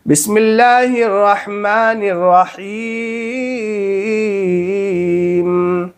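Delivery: बिस्मिल्लाहिर्रहमानिर्रहीम drawn out slowly, every syllable stretched; slow Quran recitation. Shown as a demonstration. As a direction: recite it slowly and melodically for students to repeat. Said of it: "आप मेरे साथ में मेरी ज़बान पे पढ़ते जाइए". (धीमी, सुरीली तिलावत)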